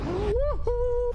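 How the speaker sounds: high-pitched "woohoo"; gleeful whoop. Real, not acted. Player escaping danger, gleeful high whoop. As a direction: whoop high in two notes. Relief in game.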